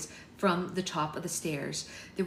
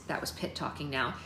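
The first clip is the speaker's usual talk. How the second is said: ordinary delivery, nothing marked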